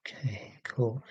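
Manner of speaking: breathy whisper to yourself; barely voiced murmur; whispered aside. Real, not acted. Aside while reading chat.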